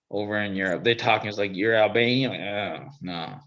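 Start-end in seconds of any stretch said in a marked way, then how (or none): none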